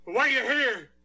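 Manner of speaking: rising panic, fast; agitated. Acted. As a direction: hurl the accusation. Accused man turns on visitor.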